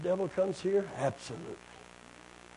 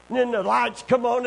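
usual speaking voice

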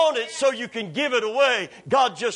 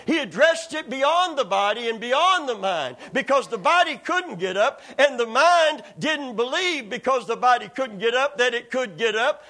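normal talking voice

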